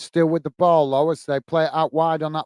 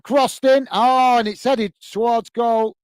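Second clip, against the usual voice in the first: higher, louder call, slowing on the header; higher excited call of a near goal; excited commentary; 5 semitones higher, 4 dB louder, slower than usual. Real, not acted. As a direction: gasp at the chance on goal.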